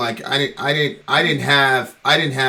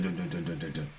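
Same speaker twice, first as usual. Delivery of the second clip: soft, low knock sounds voiced with the mouth; imitating a knock on his apartment door; imitated knocking; 8 semitones lower, 15 dB quieter than usual. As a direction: mimic someone knocking at the door, quietly. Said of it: "And then I hear dun".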